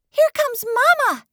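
high, loud squeaky bird voice; excited baby bird. A narrator's reading. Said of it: "hissed Pip".

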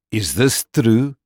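a hostile farmer doubting the stranger; gruff Boer farmer. A narrator's reading. Boer demanding the truth from Holmes, slow and loud. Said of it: "the Boer said".

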